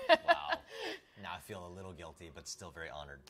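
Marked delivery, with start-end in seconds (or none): 0.0-0.5 laughing wow. Real, not acted. Guilt gift, laughs.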